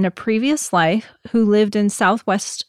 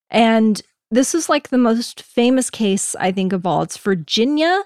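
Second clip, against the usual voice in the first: normal talking voice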